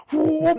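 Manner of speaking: high-pitched "whoo" of a racing car; drawn-out high whoosh; speeding whoop. Retold or imitated.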